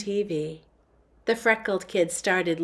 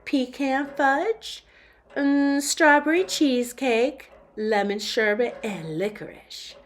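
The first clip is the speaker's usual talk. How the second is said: slow, higher Southern drawl mixed with upper crust British; a fussy customer in a half Southern, half posh British accent; drawn-out Southern-British accent ordering ice cream; 3 semitones higher, 4 dB louder than usual. Acted. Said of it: "in a strange accent that was half Southern drawl and half upper crust British".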